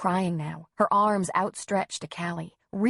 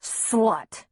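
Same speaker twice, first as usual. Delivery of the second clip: spat-out insult, slightly raised; angry rant; 3 semitones higher than usual. A narrator's reading. Husband yanking wife's arm, angry insult. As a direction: throw the word as an accusation. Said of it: "nearly drowned Griff's ranting".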